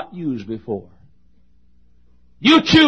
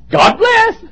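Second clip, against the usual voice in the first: high, loud comic sign-off; a hint of Red Skelton's bright farewell; 7 dB louder than usual. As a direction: toss it off like an old comedian. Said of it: "reminds me of Red Skelton".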